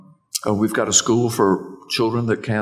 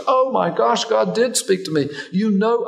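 much higher, astonished retold outburst; amazed exclamation; 12 semitones higher than usual. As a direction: imitate her stunned realization in a high voice. Retold woman's amazed reaction to shared dream.

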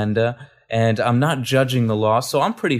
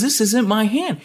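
much higher, stammering disbelief; he can't believe his own hand, voice shooting up; astonished; 10 semitones higher than usual. Retold or imitated. Kevin stunned his hand opens, high astonished stammer.

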